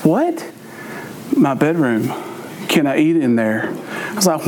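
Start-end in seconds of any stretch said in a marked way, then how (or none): 0.0-0.5 high, rising "what?"; mock disbelief, pitched up; puzzled father's sharp upswing; baffled dad. Baffled father questions his son. 1.4-3.7 lightly voiced son, low-pitched; low, matter-of-fact kid reply; imitating a son asking permission; young son's reply. Son's low answer to baffled dad.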